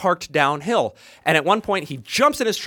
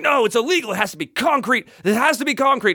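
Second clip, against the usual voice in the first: higher-pitched mock indignation; indignant insistence; 5 semitones higher than usual. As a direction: imitate a flustered man insisting on the rules. Imitating worker's insistent objection.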